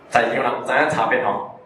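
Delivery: ordinary talk, nothing marked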